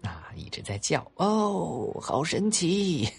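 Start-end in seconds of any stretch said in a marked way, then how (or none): 1.2-3.1 put-on high, slow amazed child cry; excited kids shouting. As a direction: gasp with wonder like a boy. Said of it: "拍着手大喊着".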